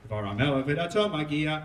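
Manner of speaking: steady liturgical chanting of scripture; a cantor reading the scroll; Megillah cantillation. Acted. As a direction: chant the Hebrew verses in the traditional trope. Chanting Megillah chapter four.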